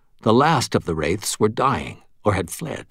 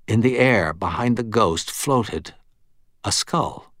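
ordinary delivery, nothing marked

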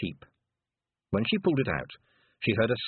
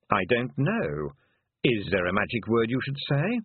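unhelpful toad. Acted. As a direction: hand the question back, unhurried. Toad throws her question back.

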